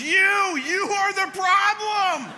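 high, loud, slow accusation; wife naming him as the problem, shouted high; imitated wife's accusation. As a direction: cry it out high and loud, slowly. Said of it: "and she goes".

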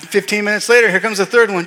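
usual speaking voice

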